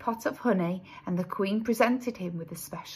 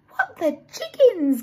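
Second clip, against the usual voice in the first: high, louder shocked bees; gasped shock; 10 semitones higher, 5 dB louder than usual. A narrator's reading. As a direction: blurt out in startled disbelief. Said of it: "gasped all the little bees".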